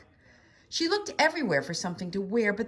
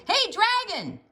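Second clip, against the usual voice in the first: high, loud shout into the ear; 5 dB louder, slower than usual. A narrator's reading. Princess shouting into the dragon's ear. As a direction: yell to wake him. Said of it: "shouted as loud as she could".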